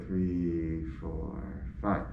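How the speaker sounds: slow, soft, low count; calming breath count. Shown as a demonstration. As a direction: count each deep breath out quietly, drawing it out. Repeats the deep breathing count.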